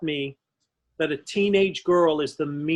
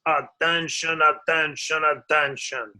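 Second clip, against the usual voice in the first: captain impression; 4 semitones lower than usual. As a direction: drone it like a pompous captain announcing. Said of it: "he sounded exactly like this".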